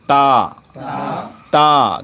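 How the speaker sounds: تا said light and thin, from the front of the mouth. Shown as a demonstration. Teacher showing pupils a thin تا. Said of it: "نہایت ہی پتلی آواز سے پڑھ رہا ہوں".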